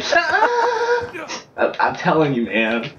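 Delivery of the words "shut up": mock moaning. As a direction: moan it out high, hammed-up. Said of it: "she starts moaning".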